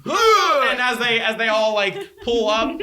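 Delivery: loud, high, laughing delivery; amused narration. Real, not acted. Game master describing guards heaving, loud and laughing. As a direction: carry on narrating through the laughter.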